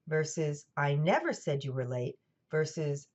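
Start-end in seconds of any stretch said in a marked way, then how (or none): 0.8-2.2 "never" punched with a pitch jump; stress on NEVER. Shown as a demonstration. Lecturer showing how emphasis changes meaning, stress on "never". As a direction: hit the word "never", rest unstressed. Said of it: "which word you emphasize".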